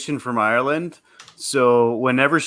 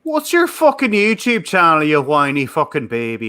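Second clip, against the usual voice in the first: put-on Irish accent. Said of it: "That was Irish".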